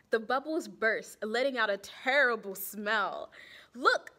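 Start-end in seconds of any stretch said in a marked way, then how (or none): 3.7-4.1 Carlos calls out, loud and eager; a loud, eager "look!"; excited cry. Acted. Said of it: "cried Carlos".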